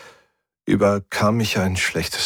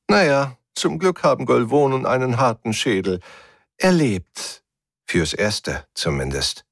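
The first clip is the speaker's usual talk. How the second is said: higher sneering lilt, dropping at the end; mocking; 4 semitones higher than usual. A narrator's reading. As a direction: taunt with false reassurance. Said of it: "sagte die Stimme höhnisch". (höhnisch)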